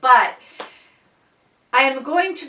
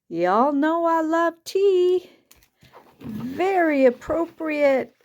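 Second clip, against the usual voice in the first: touched; 6 semitones higher, slower than usual. Real, not acted. Touched by a teacup gift.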